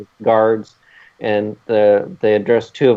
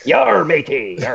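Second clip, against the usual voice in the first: higher, hammed-up pirate growl; silly pirate yar; 9 semitones higher, slower than usual. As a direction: play a cartoon pirate. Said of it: "The pirate?".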